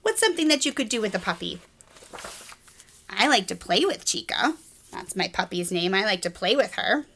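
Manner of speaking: ordinary delivery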